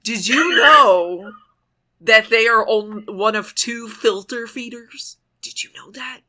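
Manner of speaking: slow, drawn-out mock "did you know"; pointed trivia delivery. Countering a know-it-all with shark facts, slow and mock-momentous. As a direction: stretch it out like astonishing trivia.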